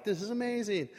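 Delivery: high-pitched retold excitement; amazed delight. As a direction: marvel with voice lifted high. Egyptians react to heartwarming news.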